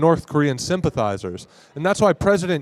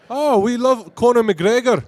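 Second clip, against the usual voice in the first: put-on high, drawn-out Irish accent; slow it down in a raised-pitch Irish accent; 7 semitones higher, slower than usual. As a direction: put on a playful Irish brogue. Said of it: "That's pretty good accent there".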